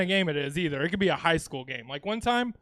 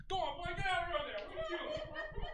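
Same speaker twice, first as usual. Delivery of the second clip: high, distant Southern holler; quick, thin Southern drawl, yelled from far away; twangy Southern drawl; 11 semitones higher, 17 dB quieter than usual. Acted. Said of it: "like, a twangy drawl".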